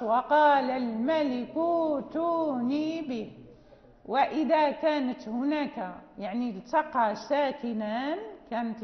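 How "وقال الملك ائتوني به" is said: وقال الملك ائتوني به recited slowly, ائتوني with a long u and no hamza; Warsh reading. Shown as a demonstration. Teacher demonstrating the Warsh reading to the class. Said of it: "إذا قرأنا لورش".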